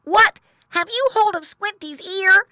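high, loud astonished question; shocked boy pig. A narrator's reading. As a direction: exclaim in a high young pig voice.

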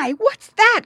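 high, loud startled piglet; alarmed high-pitched question; startled cry. A narrator's reading. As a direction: cry out in sudden surprise. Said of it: "cried Squinty".

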